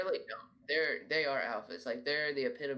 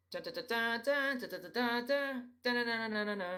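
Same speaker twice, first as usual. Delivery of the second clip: high, squeaky goose chatter; 9 semitones higher than usual. Retold or imitated. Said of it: "some geese will go".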